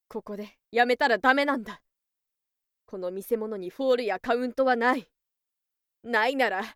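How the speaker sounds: usual speaking voice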